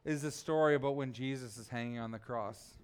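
low, soft storytelling with long pauses; solemn preaching. Real, not acted. Preacher asking the congregation to picture Jesus on the cross, solemn. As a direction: let each phrase hang quietly in the silence.

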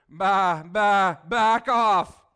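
loud mock bleating sheep; hammed-up sheep baa. Preacher imitates cornered sheep.

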